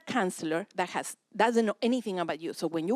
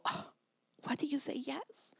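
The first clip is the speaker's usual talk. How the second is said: whispered, voiceless aside to a spouse; mock whisper, puzzled; hushed question; 6 semitones higher, 9 dB quieter than usual. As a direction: keep it secret from the kid, whisper it. Parents compare notes, child unaware.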